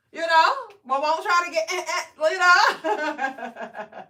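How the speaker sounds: loud, real laughter breaking the words; laughing through words. Real, not acted. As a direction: laugh it out while talking. Laughing while telling about mom.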